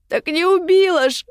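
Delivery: high, piercing protest; falsetto cry. A narrator's reading. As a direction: cry it out, pitch shooting up. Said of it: "Женин крик электрическим фальцетом разрезал воздух".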